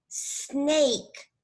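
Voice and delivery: "Snake" begun with a sustained s; long initial S. Shown as a demonstration. Teacher modelling the word for a child, long initial S. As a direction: linger on the s, then finish "Snake". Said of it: "al principio hago el sonido de esta".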